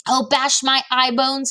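dismayed storybook giant; distressed cry. A narrator's reading. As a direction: lament it, drawing the words out. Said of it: "he cried".

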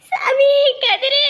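mother weeping over her unconscious son; crying out. Acted. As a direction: wail her son's name.

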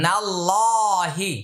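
নাল্লাহি with a thick heavy লা, stretched one alif; heavy lam, one alif. Shown as a demonstration. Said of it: "এটা এক আলিফ টান হবে, মোটা হবে". (নাল্লাহি মোটা লাম, এক আলিফ টেনে)

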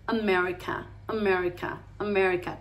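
"America" relaxed, American-style vowels; English with flow. Shown as a demonstration. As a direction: say "America" fluidly, stressing "mer". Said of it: "decir América pero con flow".

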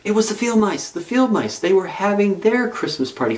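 ordinary delivery, nothing marked

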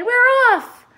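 very high, loud cry; cried. A narrator's reading. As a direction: shout it out in triumph. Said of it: "the others cried".

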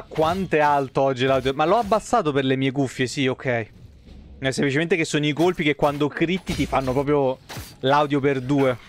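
usual speaking voice

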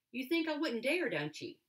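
put-on a girl teasingly accusing a toad, mildly pointed. A narrator's reading. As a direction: pin the toad down with a light accusation. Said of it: "she said accusingly".

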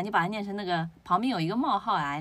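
ordinary talk

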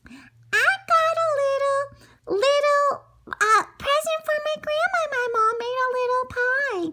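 put-on squeaky little girl introducing herself; high girl voice. Little girl telling the wolf her name, high and squeaky. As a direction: chirp it up high like a small girl.

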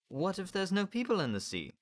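put-on high child's voice; high-pitched innocent question; questioning boy. A narrator's reading. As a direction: ask in a high little-boy voice. Boy questions shark diet.

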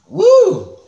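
sudden high-pitched woo at the sourness; high sour whoop. Real, not acted. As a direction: yelp high and loud as the sour hits. Reacting to sour candy.

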